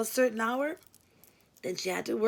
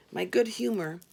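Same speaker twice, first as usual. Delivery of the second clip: ordinary delivery, nothing marked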